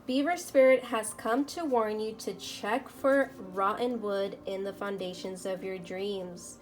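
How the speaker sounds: ordinary delivery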